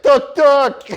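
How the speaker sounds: high, loud mock driver's tuk tuk; imitating an aggressive tuk-tuk driver; driver calling out. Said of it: "He's like".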